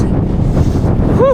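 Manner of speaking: a long unvoiced high exhale, puffing from the climb; breathless whew. Real, not acted. Cyclist riding uphill, winded, blowing out a long breathless whew. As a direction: blow out hard, winded on the bike. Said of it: "you can probably tell I'm out of breath already".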